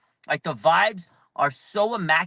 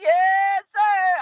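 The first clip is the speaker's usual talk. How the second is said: high, beaming Yes, sir; imitating a grinning Kyle Shanahan; happy coach; 14 semitones higher, 6 dB louder than usual. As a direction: squeal it like a delighted coach. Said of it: "Kyle's, like, so happy and smiling".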